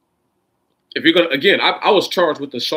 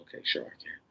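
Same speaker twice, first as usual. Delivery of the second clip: quiet dismissive; 10 dB quieter, faster than usual. Real, not acted. Dismissive reply to doubters.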